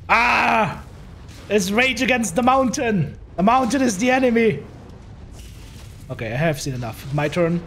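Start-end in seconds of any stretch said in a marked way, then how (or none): 0.1-4.6 raised, slow hammed-up war cry; mock battle cry. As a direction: shout it like a crazed warrior. Mocking the stuck mech.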